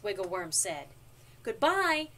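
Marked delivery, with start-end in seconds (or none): 1.4-2.1 louder, higher goodbye; cheery storybook shout; shouted farewell. A narrator's reading. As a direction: call it out as you leave.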